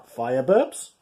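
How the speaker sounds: P=B; stress on burps. Shown as a demonstration. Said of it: "Important difference there".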